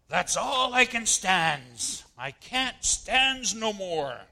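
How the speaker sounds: mock Popeye at his limit, loud and drawn out. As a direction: belt it out like the Popeye cartoon. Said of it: "not quite with that accent or inflection".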